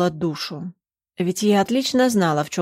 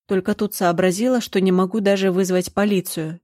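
ordinary delivery

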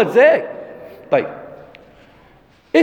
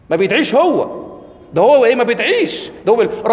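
ordinary talk, nothing marked

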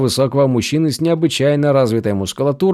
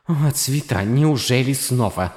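inner thought; 5 dB quieter than usual. A narrator's reading. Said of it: "подумала она".

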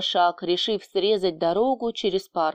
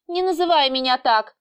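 petulant objection; 5 semitones higher than usual. A narrator's reading. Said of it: "«Ногой ещё топни»".

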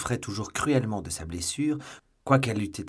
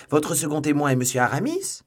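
astonished; 7 semitones higher, 8 dB louder than usual. A narrator's reading. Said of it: "d'un accent plus étonné".

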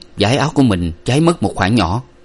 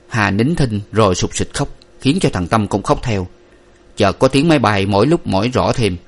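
usual speaking voice

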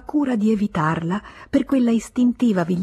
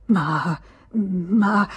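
stammering. A narrator's reading. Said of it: "balbettò lui".

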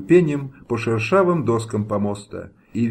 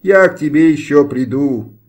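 threatening shout; 3 semitones higher, 7 dB louder than usual. A narrator's reading. Condemned man threatens executioner.

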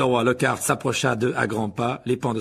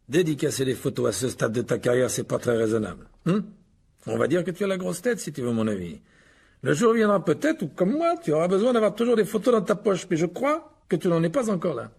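Lockhart, patronizing; 6 semitones higher than usual. Acted. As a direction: advise him smugly, as a star to a beginner. (conseils condescendants de Lockhart)

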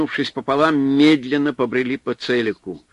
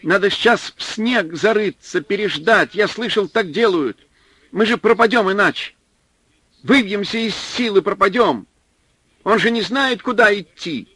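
higher, hurried, scared urging; rapid frightened pleading; 9 semitones higher, 4 dB louder than usual. A narrator's reading. Supply man clutching Fyodor's coat, pleading fast. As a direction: rush the words out in fear. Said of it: "быстро заговорил".

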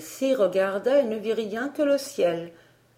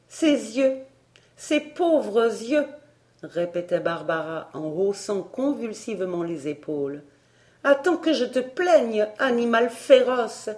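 scornful indignation; 4 semitones higher than usual. Acted. Barbara shrugging off the tutor's poor eyes, scornful. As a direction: throw his complaint back with contempt.

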